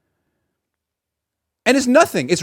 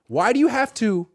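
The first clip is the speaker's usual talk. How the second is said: ordinary talk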